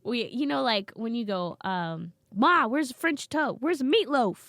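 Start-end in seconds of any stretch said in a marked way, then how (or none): 2.3-4.5 high, loud bratty holler; calling out to ma. Retold or imitated. Grown kid hollers to ma for dinner, demanding. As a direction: yell for food from another room.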